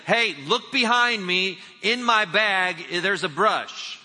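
put-on high, loud, slow old man. Hard-of-hearing man telling her the brush is in his bag, loud. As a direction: call it out like he can't hear. Deaf man answers loudly.

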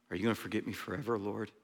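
soft, quick, barely-voiced plea to God; a desperate murmur heavenward; quiet and fast, like a secret prayer; hushed prayer.

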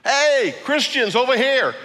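high, loud call across a crowd; mock banner call. Mock herald calling out to the crowd, loud and high. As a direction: call out like someone waving a banner. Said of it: "don't think that that was a banner".

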